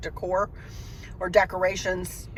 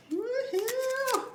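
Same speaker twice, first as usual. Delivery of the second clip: excited cheer; 4 dB quieter than usual. Real, not acted. Cheering as package opens.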